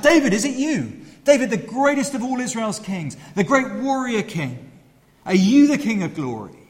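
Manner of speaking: crowd shouting. Retold or imitated. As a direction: shout up at the king in wonder. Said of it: "And people shout".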